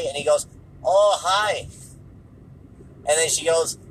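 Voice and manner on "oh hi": raised, louder imitation of his accent; mock foreign accent, pitched up; Tommy Wiseau. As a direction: greet in a put-on Wiseau-style accent. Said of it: "the Tommy Wiseau accent".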